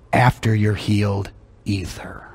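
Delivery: soft whispered punchline. Real, not acted. Preacher whispering the point to the church. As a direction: drop to a whisper to land it.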